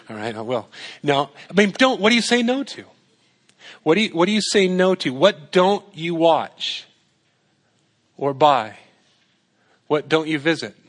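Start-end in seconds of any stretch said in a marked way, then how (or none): none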